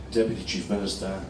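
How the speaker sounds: ordinary talk, nothing marked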